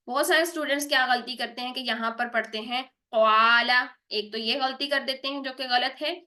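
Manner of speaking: ordinary talk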